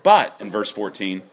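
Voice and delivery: usual speaking voice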